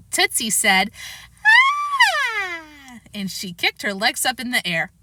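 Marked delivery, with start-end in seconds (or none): 1.4-2.6 put-on very high baby squeal; baby shriek. Baby shrieks and kicks, high squeal. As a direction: squeal like an infant.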